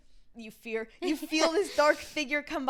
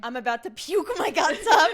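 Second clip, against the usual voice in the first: loud laughing panic at the altar; panicked, laughing; 3 semitones higher, 10 dB louder than usual. Retold or imitated. Bride at her wedding, in a loud laughing panic. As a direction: laugh it out like a bride about to be sick. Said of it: "and is like".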